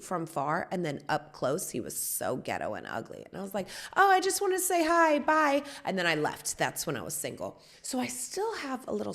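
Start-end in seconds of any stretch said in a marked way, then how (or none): 4.0-5.6 loud, high, fake-polite brush-off; high-pitched brush-off. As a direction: chirp a quick excuse and escape. Acted quick brush-off of ugly man.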